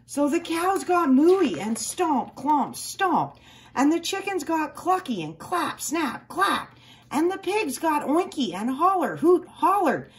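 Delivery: high, loud, gleeful romp; wild excitement. A narrator's reading. Narrator describing animals running wild at recess, gleeful. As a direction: let it burst out with glee.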